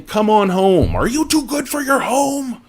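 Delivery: lightly imitating a grandpa calling someone home; Billy Madison grandpa quote. A streamer quoting the Billy Madison grandpa, mock scolding. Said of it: "Papa Noto saying".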